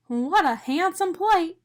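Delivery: high, slow admiring donut. A narrator's reading. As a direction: savor the compliment. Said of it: "Arnie said to himself".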